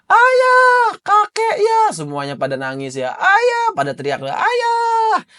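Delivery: very high, crying shout; a child screaming for his father through tears; wailing child. Acted. Crying children calling for their father, very high wails.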